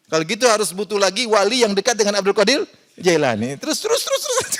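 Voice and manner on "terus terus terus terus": squeaky, much higher repeated word; mock squeal. Preacher mocks the endless chain of saints in a squeaky, much higher voice. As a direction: squeak it out in comic exasperation.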